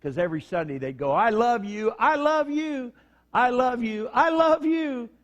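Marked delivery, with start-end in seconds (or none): 1.1-5.1 high, loud shouts of I love you; joyful shouting. Retold or imitated. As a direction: bellow it up the tiers to the wives below. Whole prison shouting love upward.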